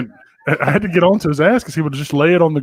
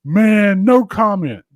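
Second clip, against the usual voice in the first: Mike Vick's reply; 5 semitones higher, 5 dB louder, much slower than usual. Retold or imitated. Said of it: "turn around ... and go".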